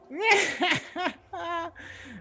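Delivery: high loud cackle; witch cackle. Acted.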